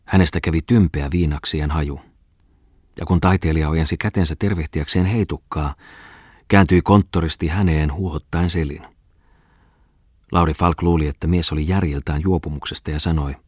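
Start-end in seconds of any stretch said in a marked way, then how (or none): none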